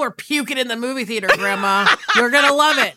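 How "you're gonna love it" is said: shout. Pretend. Shouted mock promise.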